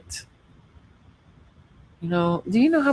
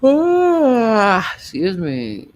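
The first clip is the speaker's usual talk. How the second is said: loud sneeze; 7 semitones higher, 8 dB louder, much slower than usual. Real, not acted. Sneeze then excuse me.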